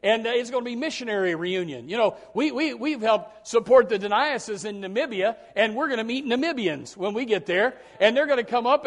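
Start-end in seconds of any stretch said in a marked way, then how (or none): none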